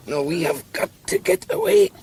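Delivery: pressing, straining urgency; urgent. Acted. Bound captive urging fellow prisoner to escape, urgent.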